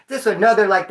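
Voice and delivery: ordinary talk, nothing marked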